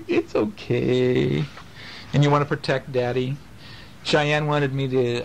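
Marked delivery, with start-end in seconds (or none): 0.1-1.4 put-on very slow, gentle child's reassurance; drawn-out soothing it's okay; child comforting. Dad imitating his kids comforting him, slow and gentle. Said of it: "you guys will come over and go".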